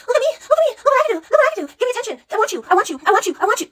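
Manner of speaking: high, whiny mock plea for attention; mocking needy woman. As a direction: ham up a clingy woman begging to be noticed. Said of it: "They're all over here like".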